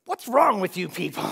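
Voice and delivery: imitating Sproul's irritated retort; exasperated outburst. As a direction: blurt it out, fed up with the question. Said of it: "and he just goes".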